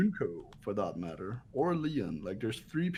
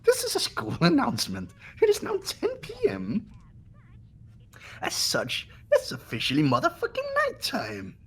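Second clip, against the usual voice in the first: Monokuma announcement. Acted. Friends watching a game, voicing the bear's nighttime announcement, hammed-up high announcer. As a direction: ham up the creepy school PA bear. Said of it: "one of my better voice acting jobs".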